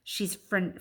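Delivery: plain reading voice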